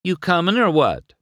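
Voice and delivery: impatient, playful holler; calling out. Acted. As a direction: call back to prod someone along. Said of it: "she called".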